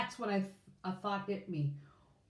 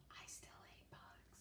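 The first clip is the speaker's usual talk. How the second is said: whisper; 25 dB quieter than usual. A narrator's reading. Whispered aside to listeners.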